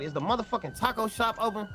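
ordinary delivery

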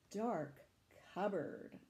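ordinary delivery, nothing marked

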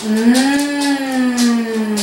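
long, drawn-out hum of eating; slow sustained mmm; yummy mmm. Shown as a demonstration. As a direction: hum as if tasting something good. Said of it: "Can you say".

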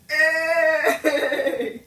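loud joyful yell; excited shout. Real, not acted. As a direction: shout it out laughing.

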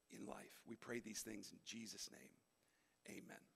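soft, low prayer voice fading out; quiet prayer. Acted. Pastor leading church in quiet prayer. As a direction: pray it softly over the room. Closing prayer before the talk.

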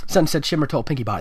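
ordinary delivery

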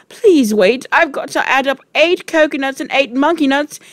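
put-on high, fast, flustered boy; flustered pleading. A narrator's reading. Wilfred begging time to add up, flustered. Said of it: "Poor Wilfred was properly flustered".

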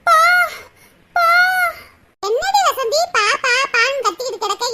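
ordinary delivery

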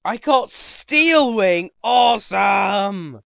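high, loud, drawn-out cheer; hammed-up excited holler; mock triumph. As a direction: shout it slowly like a huge victory. Celebrating a new move.

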